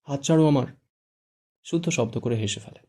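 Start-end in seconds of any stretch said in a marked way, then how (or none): 0.0-0.7 a sharp, angry, raised order; angry demand. A narrator's reading. Fara, wrist held, angrily demanding release. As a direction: snap at him to let go. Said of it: "রেগে বলে".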